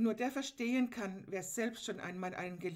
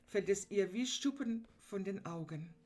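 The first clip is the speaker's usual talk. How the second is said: ordinary talk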